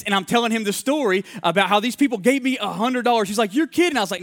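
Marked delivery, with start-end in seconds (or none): 3.5-4.0 high, amazed "you're kidding"; surprised buddy. Retold or imitated. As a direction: play the buddy who can't believe it. Buddy reacts to the story.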